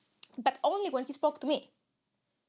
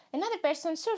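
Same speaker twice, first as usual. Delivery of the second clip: ordinary talk, nothing marked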